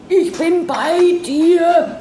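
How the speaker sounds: high, slow, frightened reassurance; slowly, a hint of a scared friend; whispered comfort. A narrator's reading. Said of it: "flüsterte Ingeborg". (Ingeborg beruhigt Maria, hoch und langsam)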